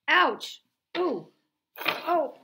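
sharp pained yelps; stubbed toe. Acted. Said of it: "I think I just stubbed my toe".

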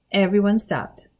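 ordinary delivery

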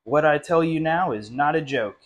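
ordinary delivery, nothing marked

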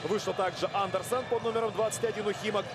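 ordinary talk, nothing marked

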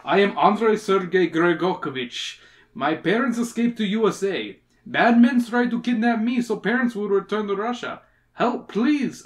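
put-on Russian accent, somewhat high; hammed-up Russian voice. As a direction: plead with a thick Russian accent. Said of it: "Russian voice".

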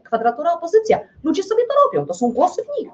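ordinary talk, nothing marked

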